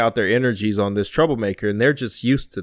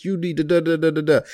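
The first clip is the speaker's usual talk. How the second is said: higher-pitched imitated rant, no real shout; mock yelling; 6 semitones higher than usual. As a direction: babble out a mock angry tirade. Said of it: "he's yelling and screaming".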